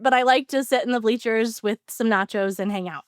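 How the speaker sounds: ordinary delivery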